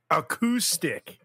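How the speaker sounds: "acoustic" with a drawn-out coup; demonstrating "acoustic". Shown as a demonstration. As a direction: slowly sound out "acoustic" as uh-KOO-stick. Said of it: "how do you say it?".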